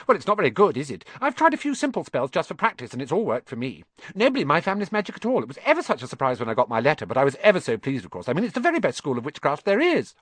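put-on high, fast, know-it-all girl; very fast, bossy. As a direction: rattle it off without pausing for breath. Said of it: "She said all this very fast".

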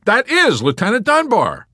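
high, booming confirmation; loud answer. A narrator's reading. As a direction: announce it loudly for all to hear. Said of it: "Yossarian answered loudly".

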